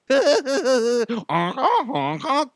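high-pitched mad clown laugh; hammed-up villain clown laughter; creepy clown cackle. Clown laughs at trapped guest.

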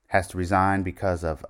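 ordinary delivery

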